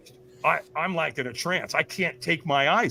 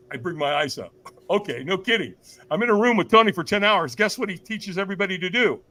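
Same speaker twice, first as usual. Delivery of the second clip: normal talking voice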